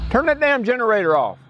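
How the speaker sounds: high, strained mock yell of an angry neighbor; imitating Dale's yell. Dale in his underwear at the door, ordering the generator off, in a high mock yell. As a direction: bark it out like a woken, furious driver.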